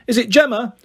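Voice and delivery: understated call in a high voice; mild mock shout from another room. A narrator's reading. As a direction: call out lightly, no real volume. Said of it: "Alice in the background shouting".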